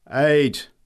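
loud, high final thunderclap of a word; thundered. A narrator's reading. Greybeard thundering his last word loudly. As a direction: roar it with fresh force. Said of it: "thundered the greybeard".

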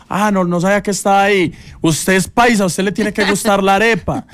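imitating Diana. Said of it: "me dice".

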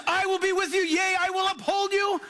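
high, ringing declaration; preached promise. Acted. Pastor quoting God's promise, high ringing proclamation. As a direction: proclaim it at full force.

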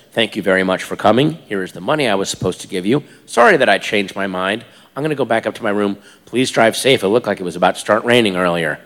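low, monotone, rapid courtesy; creepily polite. Retold or imitated. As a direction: recite it low and evenly, like a creep. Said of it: "the one thing that creeped her out".

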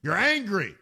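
usual speaking voice

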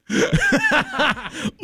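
high laugh bursting out; real laugh breaking through the bit; burst of laughter. Real, not acted. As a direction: crack up laughing.

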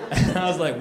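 normal talking voice

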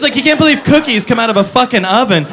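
usual speaking voice

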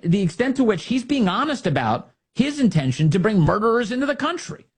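usual speaking voice

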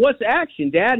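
imitating himself yelling as a boy; retold child's yell. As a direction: call out to dad like a puzzled kid. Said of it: "I'm yelling".

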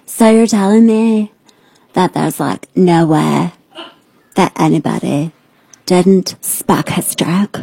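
lightly imitated slow, drawling interviewee voice; mocking Jill Biden. Host mocking Jill Biden's slow answer. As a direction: mimic her with a sneer. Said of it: "can I do this? I should be her".

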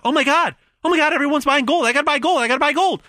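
high, fast panicked buyer; frantic fear of missing out; panicky. Retold or imitated. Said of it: "The fear of missing out".

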